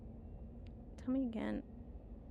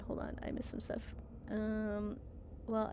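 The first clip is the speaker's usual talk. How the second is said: normal talking voice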